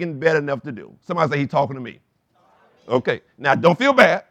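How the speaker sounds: loud, high, driving preacher's voice; preaching. Acted. Pastor urging the congregation toward their road to Damascus, preaching loudly. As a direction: preach it out to the whole room.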